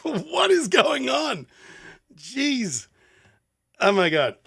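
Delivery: high, slow, drawn-out amazement; stunned disbelief. Real, not acted. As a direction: stretch it out in awe. Stunned by the music.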